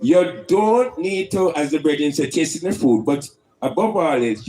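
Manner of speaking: ordinary talk, nothing marked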